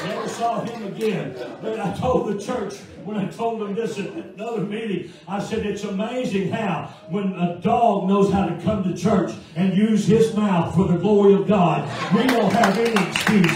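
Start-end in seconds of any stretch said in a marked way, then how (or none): 5.7-13.6 preaching. Real, not acted. Preaching the moral loudly.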